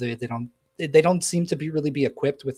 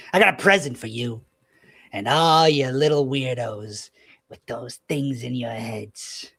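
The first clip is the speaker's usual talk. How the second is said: taunting villain; 4 dB louder than usual. Acted. Little man taunts the traitor David over a megaphone, gloating. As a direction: gloat over the traitor, then trail off smugly. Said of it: "and he goes".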